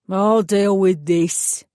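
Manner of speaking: a burly East End mechanic taking over; East End accent. Acted. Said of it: "in a distinctly East End accent".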